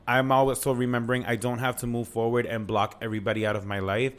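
usual speaking voice

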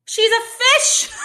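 high, loud disbelieving yell; exasperated shout. Real, not acted. Ranting at online critics, a loud disbelieving yell.

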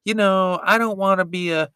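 high put-on voice for Eve, bleeping the swears; hammed-up Eve, mock dramatic plea; imitating Eve. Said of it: "Eve is just all like".

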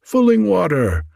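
high-pitched, urgent plea; storybook begging, pitched well up; imploring. A narrator's reading. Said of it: "they implored".

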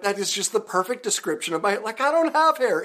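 high, loud and tickled; delighted disbelief. Retold or imitated. Retelling his thrilled reaction to the ghost-hair comment, high and loud. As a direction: squeal it out in delight. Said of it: "I'm like".

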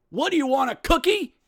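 high, incredulous comic shout; Chris Rock. Acted. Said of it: "to quote the great philosopher Chris Rock".

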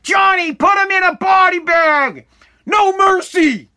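high, loud, slow coaching yell; wrestling sideline yell. Retold or imitated. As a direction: scream it from the mat like a teammate. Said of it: "we'd be like".

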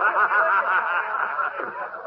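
rising scornful laugh; mocking laugh. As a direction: laugh the crowd along. Dealer laughs at the donkey.

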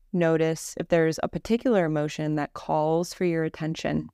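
measured Yoga Nidra narration, little pitch movement; guided meditation. Acted.

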